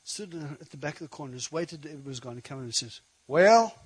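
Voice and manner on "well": loud, raised cowboy drawl attempt; attempted rancher accent. Preacher imitating a cowboy rancher, attempted ranch accent. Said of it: "I can't do that accent".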